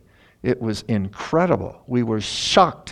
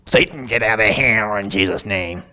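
a louder, commanding rebuke of Satan; angry rebuke demo; 3 dB louder than usual. Shown as a demonstration. Pastor demonstrating his angry rebuke to the congregation, commanding. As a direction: show the angry-spirit rebuke. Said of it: "You know, like that".

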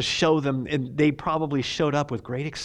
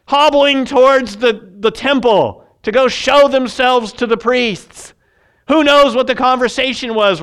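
fervent preaching; 9 semitones higher, 11 dB louder than usual. Acted. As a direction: preach it out to the whole hall. Preaching at full force.

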